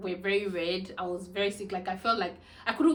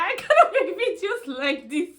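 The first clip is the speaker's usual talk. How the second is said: said high and loud through laughter; giggling, high and loud; laughing through it; 10 semitones higher, 10 dB louder than usual. Real, not acted. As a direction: laugh the words out. Laughing while retelling her own words.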